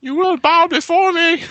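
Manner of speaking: very high, slow villain decree; dark lord. Retold or imitated. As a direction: proclaim like a cartoon tyrant. Hammed villain line, answering Zod quote.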